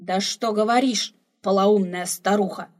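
put-on loud, scolding old man; a loud, exasperated rebuke; indignant exclamation. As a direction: snap back at the old woman loudly. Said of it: "воскликнул старик".

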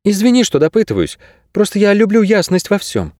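put-on high-pitched young heroine; voiced girl character. A narrator's reading. Narrator voices the girl Kase.

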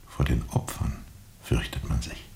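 dark, voiceless whisper. Acted. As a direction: breathe it out, a grim secret. Düstere Erkenntnis, Stimme sinkt. (düster geflüstert)

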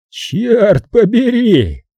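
high, drawn-out outburst of outrage; blustering big-man exclamation; thunderous. A narrator's reading. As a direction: roar it slowly, pitch jumping up. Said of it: "вернулся громоподобный голос".

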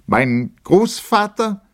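slow, very high declaration left hanging; impassioned opening. A narrator's reading. Lieutenant begins again before the officers, impassioned opening. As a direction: launch a solemn protest, drawn out.

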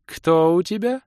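put-on high, cold young girl; icy girl's voice. A narrator's reading. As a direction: chill the question suddenly. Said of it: "резко похолодевший голосок юной хозяйки".

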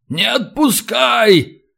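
high, slow drawn-out wail; desperate long high call; pleading cry. A narrator's reading. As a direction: beg not to be dropped, stretching the words. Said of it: "снова подал голос Катран".

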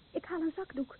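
quiet voice from the dark; soft and distant. Acted. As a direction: murmur it, turning away in tears. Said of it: "Waarom praat je zo zachtjes?".

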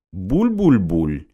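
slow bubbling burble; wet gurgling. Acted. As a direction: gurgle it slowly like water. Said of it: "Голос был мягким, напевным, влажным".

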